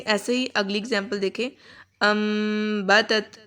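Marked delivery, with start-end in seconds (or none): 2.0-3.4 ام بتت drawn out very slowly, M hummed in the nose; slow nasal ghunna. Shown as a demonstration. Teacher models the ghunna slowly for students. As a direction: stretch the M of ام بتت through the nose. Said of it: "بلکہ ادھر غنہ کریں گے".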